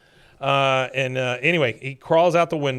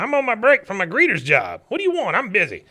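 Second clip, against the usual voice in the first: higher, louder put-on old man brushing his son off; gruff dad voice; 8 semitones higher, 6 dB louder, faster than usual. Cotton shooing Hank away, higher and louder grumpy voice. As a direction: bark it out like a grumpy old veteran hiding in a stall.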